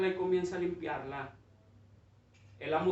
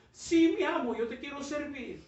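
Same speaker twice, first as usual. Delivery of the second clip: much higher, loud burst of yes; fervent bamboo; 8 semitones higher, 4 dB louder than usual. Acted. Bamboo fervently agreeing to serve, high loud. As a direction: answer with fervor.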